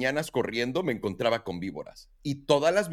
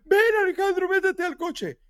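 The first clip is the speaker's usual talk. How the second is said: much higher mock yell from the wife; wife calls him back into the car, much higher; retold yell. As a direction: imitate the wife calling him in. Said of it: "Belén me empieza a gritar".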